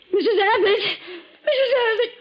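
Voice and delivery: high, loud panicked cries of her name; frantic calling. Acted. Housekeeper screaming for her mistress, frantic loud calls.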